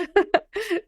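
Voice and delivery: high, short laugh; quick amused chuckle. Real, not acted. Founder reacting to host's teasing question, high laugh.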